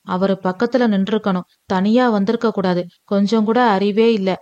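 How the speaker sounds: usual speaking voice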